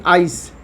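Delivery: आइस with a clear long ai vowel; ice with long i. Shown as a demonstration. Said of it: "आई की बात करें तो आईसीई". (आइस में लंबा आई)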